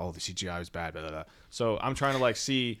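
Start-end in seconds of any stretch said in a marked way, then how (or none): 0.0-1.2 mocking friends. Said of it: "you guys always talk about".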